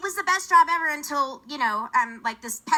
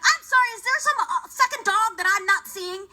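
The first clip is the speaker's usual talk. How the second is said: sarcastic retort; 8 semitones higher, 3 dB louder than usual. Retold or imitated. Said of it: "And I'm like".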